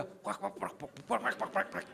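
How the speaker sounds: rapid mouth-made clattering; rattling taxi noise. Retold or imitated. Preacher mimics the rattling old taxi, clattering noises. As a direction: imitate a rickety car rattling.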